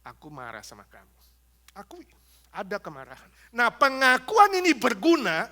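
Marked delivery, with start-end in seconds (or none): 0.0-1.1 quiet honest admission. Shown as a demonstration. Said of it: "juga bilang sama orang itu".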